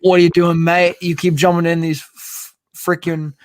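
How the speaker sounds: badly faked English accent; terrible English accent. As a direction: put on a bad English accent, scolding a mate. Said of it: "that's a terrible accent".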